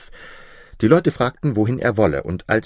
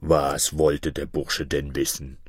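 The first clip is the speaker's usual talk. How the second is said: low, slow creature voice; deep, drawn-out monster bird; griffin demanding answers; 7 semitones lower than usual. Acted. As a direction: ask slowly, down deep like a great beast. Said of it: "rief der Vogel Greif".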